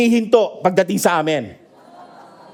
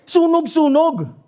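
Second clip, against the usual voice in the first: higher, slow cry of "Sunog, sunog"; mock yell; 8 semitones higher, slower than usual. Neighbour crying fire next door, mock yell. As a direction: call out the alarm like the neighbour did. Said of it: "May naririnig ako sumisigaw".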